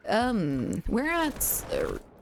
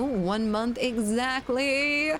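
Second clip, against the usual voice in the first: slow, drawn-out, higher cry of excitement; excited exclamation; 6 semitones higher, much slower than usual. Real, not acted. Streamer thrilled the game launches in a month, excited. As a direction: stretch each word with glee.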